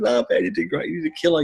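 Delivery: high, upbeat reassuring voice; reassuring bandmate. Retold or imitated. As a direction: cheer him up brightly. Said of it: "It's like".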